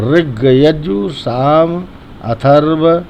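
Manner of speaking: very slow "ऋग यजु साम"; slow and clear. Shown as a demonstration. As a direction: stretch each word out. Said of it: "साथ में बोलेंगे". (बहुत धीरे पाठ)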